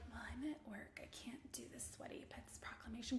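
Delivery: soft whispered excuse; mocking whispered excuse from a worker. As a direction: whisper the excuse like hiding at work. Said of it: "a lot of people are like".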